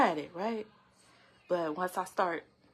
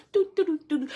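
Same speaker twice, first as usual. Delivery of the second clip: high, loud "do-do-do" ditty; bouncy mock skipping-along sound, pitched way up; playful happy humming noises; 10 semitones higher, 9 dB louder, much faster than usual. Gardener off with a little basket at harvest, playful dooting.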